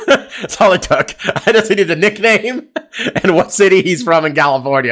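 laughing as he talks, amused at himself. Real, not acted. Host laughing at himself to his co-host, amused. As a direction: giggle through the line, tickled by your own joke.

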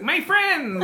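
high, gleeful drawn-out cry; Gerby. Acted. Player voices Gerby greeting the gift, high delighted cry. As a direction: call it out high, stretching the words. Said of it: "The Gerby's like".

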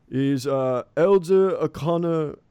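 high, loud, drawn-out call; yelling out. Acted. Captain calls out to the village for the elder, yelling out. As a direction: call out slowly over a crowd, voice climbing at the end. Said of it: "he yells out".

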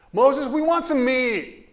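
high, loud, slow whining; whiny toddlers. Retold or imitated. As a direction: drag it out like a sulking toddler begging. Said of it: "I'm sure they sounded like whiny little toddlers".

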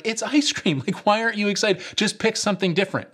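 high, pleading parent voice. Retold or imitated. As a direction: coax the screaming kid, exasperated. Parents pleading with screaming kid.

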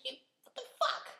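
high-pitched exasperated cursing with a laugh; comic, squeaky-high outrage; retold anger. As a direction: relive being mad, voice shooting up. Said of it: "I was mad as shit".

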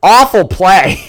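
high, loud, drawn-out complaint; disgusted yell. Real, not acted. Fan watching an awful play, disgusted yell. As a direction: cry out slowly in disgust.

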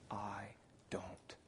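whispered, slow and barely there; small hushed "I don't know"; timid whisper. Retold or imitated. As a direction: breathe it like an unsure boy. Boy's timid answer retold.

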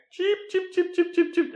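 high mock chick peeps; chicken noise. Guys mocking fallen Peter, high peeps. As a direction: squeak the peeps out. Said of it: "they all start making fun of him".

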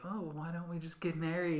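lightly voiced smiling fiance; smiling weird voice. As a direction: say it through a big fake smile. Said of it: "he does this weird voice where he's, like, always smiling".

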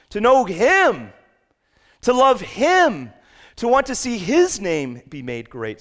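impassioned pulpit climax; preaching at full force. Acted. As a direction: cry out the pursuit of God. Sermon climax.

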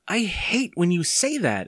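put-on high-pitched, drawn-out boyish protest; a child's mild anger, pitched high and slow; angry exclamation. A narrator's reading. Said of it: "Evan exclaimed angrily".

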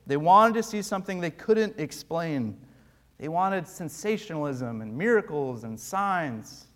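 ordinary talk, nothing marked

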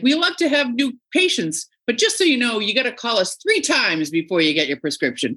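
high, sugary receptionist voice; sweet fake customer service tone; fake cheerful receptionist. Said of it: "Be like".

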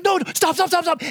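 put-on high, insistent child voice; kid shushing dad. Child cutting dad off, high insistent stop. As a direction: stop him before he speaks.

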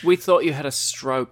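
jokey British jab; mock British accent. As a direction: tease in a hammy British accent. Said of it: "mocking British accent back".

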